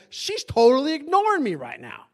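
loud, high outburst of grievance; mock indignation. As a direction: complain loudly at being ignored. Reenacts indignation at being ignored.

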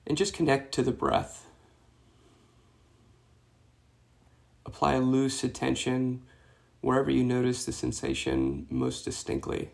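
soft meditation voice with long pauses; guided meditation. Real, not acted. Meditation teacher guiding runners to release tension, soft guided-meditation voice. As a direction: guide them gently into the breath, hushed and unhurried.

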